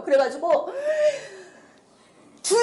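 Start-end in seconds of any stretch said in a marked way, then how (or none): 2.4-2.6 loud, high cry of prayer; holding back irritation. Retold or imitated. Praying so as not to get annoyed, strained high cry. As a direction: cry out to God to fend off annoyance. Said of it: "제가 짜증을 안 내려고".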